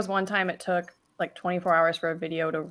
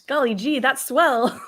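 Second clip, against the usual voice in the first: high, louder golly-gee cheer; wholesome 1950s kid; 8 semitones higher, 6 dB louder, much slower than usual. Pretend. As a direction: gush like an innocent fifties kid. Mock reaction to pied proving out.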